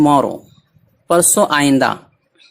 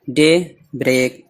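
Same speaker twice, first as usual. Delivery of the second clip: Daybreak slowly, with a gap before break; word in parts; 2 semitones lower, much slower than usual. Shown as a demonstration. Teacher breaking down a new word, daybreak in slow parts. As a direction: split daybreak into day and break.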